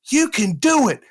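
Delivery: high, loud imitation of an encouraging kid; little boy cheering. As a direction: pitch it way up like an earnest little boy. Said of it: "about nine or ten years old, he goes".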